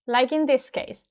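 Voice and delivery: ordinary delivery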